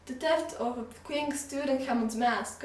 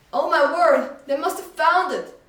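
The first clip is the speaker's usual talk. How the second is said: much louder and higher burst; excited exclamation; 7 semitones higher, 11 dB louder than usual. Acted. Shopkeeper excitedly interrupts the news broadcast, much louder. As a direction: blurt it out in delight. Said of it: "exclaimed Ray".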